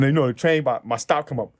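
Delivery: ordinary talk, nothing marked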